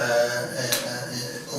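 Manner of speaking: ordinary talk, nothing marked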